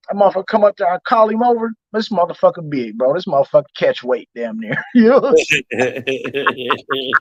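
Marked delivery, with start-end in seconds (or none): none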